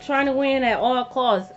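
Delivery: ordinary delivery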